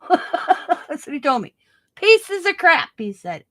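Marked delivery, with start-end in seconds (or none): 2.0-2.9 high, loud mock-dismissive outburst; hammed-up old German tradesman passing judgment; grumpy German repairman. Scissors man dismissing her scissors, mock outburst. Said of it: "he's an old German guy".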